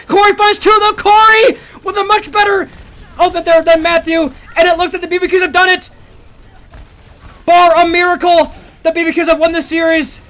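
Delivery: high, loud victory call; excited shouting. Real, not acted. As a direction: scream the win to the crowd. Caster calls the series win.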